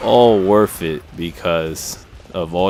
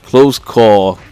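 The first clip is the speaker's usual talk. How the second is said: loud; 3 semitones higher, 8 dB louder, much slower than usual. Real, not acted. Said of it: "you always get them co".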